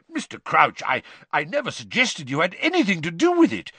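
flustered, defensive and hesitant; flustered mutter. A narrator's reading. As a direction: stumble through an embarrassed denial. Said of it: "muttered Amos Diggory".

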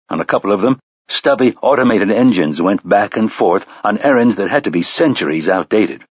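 ordinary delivery, nothing marked

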